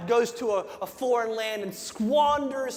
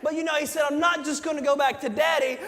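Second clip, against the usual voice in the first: preaching at full force; 4 semitones higher than usual. Acted. Pastor retelling the prodigal son to his church, shouting with fervor. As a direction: preach it big, like you're lifting the whole room.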